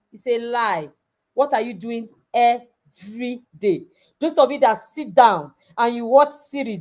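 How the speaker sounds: ordinary talk, nothing marked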